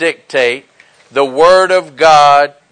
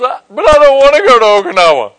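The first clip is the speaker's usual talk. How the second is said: higher, quick protest in a put-on voice; mock whining protest; 5 semitones higher, 3 dB louder, faster than usual. Preacher imagines dad refusing orders to Okinawa, mock protest. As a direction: mimic a reluctant Marine objecting.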